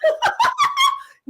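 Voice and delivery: shrieking with real laughter; high, loud laughing shout. Real, not acted. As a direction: burst out laughing loudly. Cracks up telling the story.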